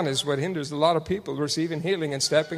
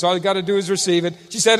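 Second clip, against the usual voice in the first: ordinary delivery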